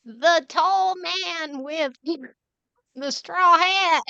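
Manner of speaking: slow high sheep voice. Acted.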